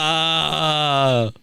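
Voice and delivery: high, fake crying wail; a hammed-up sob; mock whimper. Host mimicking Vince whimpering to the cop. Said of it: "what he said to the cop was".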